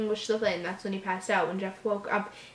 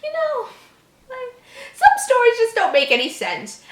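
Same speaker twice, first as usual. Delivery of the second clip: mock whine; 7 dB louder than usual. Real, not acted. Mock despair over the story.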